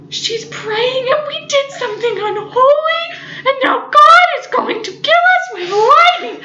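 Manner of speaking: mock-tearful dread; laughing mock terror. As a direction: panic through giggles. Terrified after interrupting prayer.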